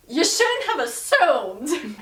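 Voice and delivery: mock cold reply. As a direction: drag it out as a snub.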